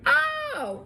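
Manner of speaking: very high stunned oh; shocked realization. Retold or imitated. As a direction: gasp of disbelief on oh. Realizing betrayal.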